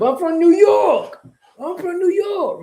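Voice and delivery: high, drawn-out mock New York brag; forced New York accent. Mocking immigrants claiming the Knicks' win, forced New York accent. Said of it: "They do be forcing that accent".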